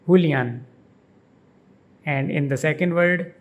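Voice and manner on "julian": Julian as hoo-lee-AHN, the last syllable hit; stress on the last syllable. Shown as a demonstration. Teacher shows where the stress falls in Julian. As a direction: Julian with an h sound and the weight on the final un. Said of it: "The emphasis is on the last syllable un".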